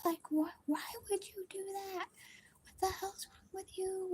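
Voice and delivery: a mock scream, whispered high and breathless; hushed high-pitched panic, imitating a scream; retold panicked screaming. At the friend who ripped off the bunny's ears, a whispered high mock scream. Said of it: "I was just screaming".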